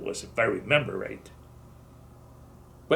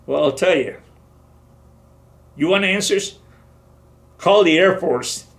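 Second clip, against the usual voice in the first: heated retort; 6 semitones higher, 6 dB louder than usual. Retold or imitated. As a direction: throw the blame back hard. Soldier arguing back at sergeant.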